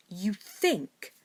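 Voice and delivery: sarcastic repeat of his words; sarcastic echo. A narrator's reading. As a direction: throw the words back mockingly. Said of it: "Draco echoed sarcastically".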